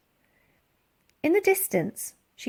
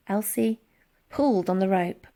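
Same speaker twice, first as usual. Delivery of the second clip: ordinary delivery, nothing marked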